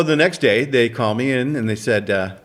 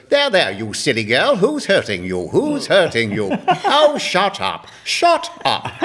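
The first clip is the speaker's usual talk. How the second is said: hammed-up English accent, climbing much higher; 6 semitones higher, slower than usual. Auditioner reads the part he was given, English accent. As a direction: play the line in a theatrical English accent. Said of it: "Can you do an English accent?".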